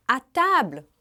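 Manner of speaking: à table chamado alto, como quem chama para comer; called out. Shown as a demonstration. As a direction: gritar à table para a família. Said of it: "a gente diz". (chamando para a mesa, em voz alta)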